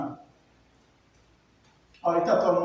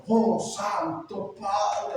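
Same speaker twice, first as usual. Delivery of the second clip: drawn-out, solemn mock prayer voice; voz fingida de rezo; 2 semitones higher, much slower than usual. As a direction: put on a pompous praying voice, stretching each word. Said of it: "hasta cambian la voz". (voz de oración fingida, muy alargada)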